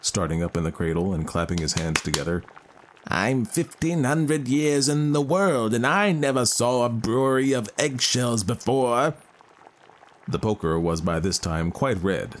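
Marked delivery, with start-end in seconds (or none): 3.1-9.2 high, slow ancient little voice; a changeling speaking like an old man. Acted. Old fairy exclaims gleefully.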